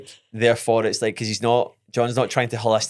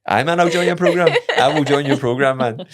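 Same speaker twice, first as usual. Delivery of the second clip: higher, laughing acted reply; mock eager agreement; 4 semitones higher, 4 dB louder than usual. Passenger humours driver's supposed sales pitch, mock eager. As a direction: play along as a keen recruit.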